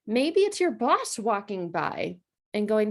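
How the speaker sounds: usual speaking voice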